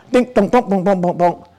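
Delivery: mouth-made beeps and bonks; playful sound effects of a computer; computer noises. Retold or imitated. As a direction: imitate a computer clanking.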